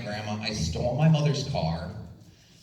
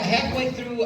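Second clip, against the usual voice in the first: ordinary talk, nothing marked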